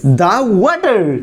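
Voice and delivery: দা ওয়াটার with "the" as "dha", stretched slowly. Shown as a demonstration. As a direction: draw out দা ওয়াটার, with a short-a "duh/dha" article, not "dhee". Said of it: "তাহলে উচ্চারণ হবে কি". (দা ওয়াটার, ধীরে টেনে "দা" উচ্চারণ)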